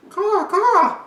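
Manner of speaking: very high, loud cawing; high imitation bird cry; crow call. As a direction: squawk like a crow.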